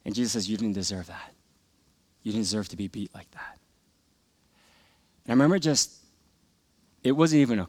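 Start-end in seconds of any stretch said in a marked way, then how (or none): 0.5-3.6 hushed, lower reassurance; soft consoling voice. Retold or imitated. Jesus consoling a beaten boy, low hushed reassurance. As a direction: tell them gently and quietly, low in pitch.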